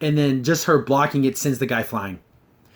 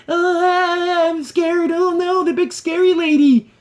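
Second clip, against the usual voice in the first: high, whiny hammed-up fright; mock scared soldiers; 13 semitones higher, 3 dB louder, slower than usual. Reviewer mocking soldiers scared of She-Ra, high whiny mock fear. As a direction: squeal it like a pathetic coward. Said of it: "they have to be shown to be freaking".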